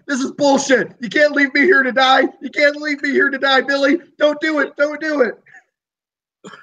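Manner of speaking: high, frantic panicked begging; desperate pleading prisoner. Acted. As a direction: beg for your life, voice cracking high. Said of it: "Trevor chimes in here, is like".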